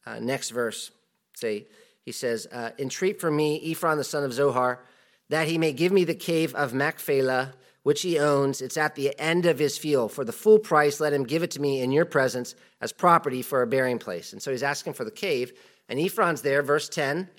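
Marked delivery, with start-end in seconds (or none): 2.8-14.3 raised, louder Bible reading voice; scripture reading. Acted. Preacher reads scripture aloud to church, louder. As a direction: read the verse out to the congregation.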